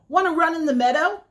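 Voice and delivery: high, fast sheep voice. Acted. Sheep inviting the spider to the meadow, high and fast. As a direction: bounce it like an eager little sheep.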